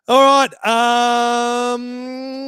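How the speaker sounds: hyped-up kickoff. Real, not acted. Hyping up the news segment.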